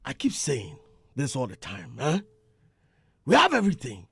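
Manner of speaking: booming, high, shouted Bulldog imitation; Bulldog impression. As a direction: belt it out like Bulldog, laughing. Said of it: "make I do my Bulldog impression".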